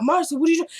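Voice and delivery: high-pitched alarmed retelling; high, mock-frantic question. Acting out the alarmed man's question.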